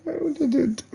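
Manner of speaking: usual speaking voice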